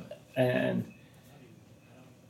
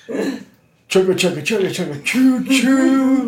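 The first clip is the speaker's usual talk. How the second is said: chugging toy train voice; 10 semitones higher, 5 dB louder, slower than usual. Pretend. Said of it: "the hype train is at Brett's station".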